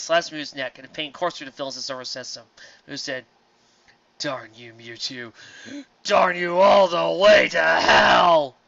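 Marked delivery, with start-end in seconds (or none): 4.2-8.6 slow, loud hammed shout; villain's curse. Villain Mu curses Mewtwo, slow loud hammed shout. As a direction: drag out the curse like a dying villain.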